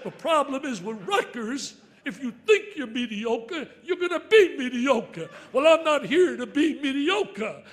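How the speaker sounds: booming and slow, hammering every word; roaring motivational speech; fired-up shout. Real, not acted. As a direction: preach it loud against being mediocre. Fired-up speech about mediocrity.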